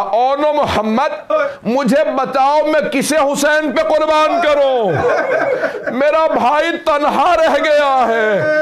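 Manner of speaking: a mother weeping over her son's body; weeping cry. Acted. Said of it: "एक जुमला रो के कहा".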